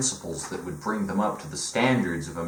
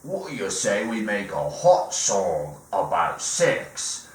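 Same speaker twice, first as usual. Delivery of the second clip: mock British accent. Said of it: "[name] turns around and he's like".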